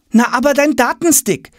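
put-on very high boy's voice; insistent boy. A narrator's reading. Tom reminds father of the stick, insistent. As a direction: prompt dad in a high boyish register.

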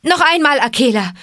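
high, loud call to a companion; shouted command. Acted. Mowgli to Akela before the herd charges, shouting. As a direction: shout the order out. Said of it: "schrie er".

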